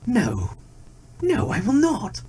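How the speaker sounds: high, eager denial; tiger's false promise. A narrator's reading. Tiger assuring the deer, high eager false denial. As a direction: protest too brightly. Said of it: "replied the tiger".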